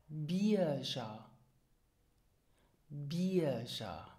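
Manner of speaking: "Bierger" as BEE-a-zha; drawn-out "Bierger" with zh for the g; pronunciation example. Shown as a demonstration. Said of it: "repeat after me".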